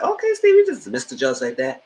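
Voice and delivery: high squeaky okay of disbelief; impressed surprise. Retold or imitated. Viewer reacting to Steve dismissing the judge, high surprise. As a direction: act amazed at his boldness. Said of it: "And I said".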